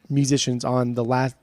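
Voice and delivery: ordinary talk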